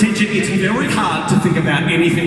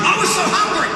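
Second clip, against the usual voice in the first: high exclaim; 10 semitones higher than usual. Acted. Preacher raising his voice.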